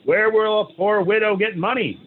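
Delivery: a sick widow bitterly complaining to the rabbi; bitter complaint. A narrator's reading. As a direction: wail at her poverty. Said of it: "She complains bitterly".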